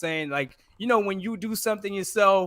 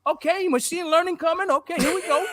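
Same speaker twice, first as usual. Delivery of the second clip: high, louder retelling of being thrilled; hyped-up excitement; 7 semitones higher, 4 dB louder than usual. Retold or imitated. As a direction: play up the eager fan getting hyped. Acting out his hyped reaction to videos.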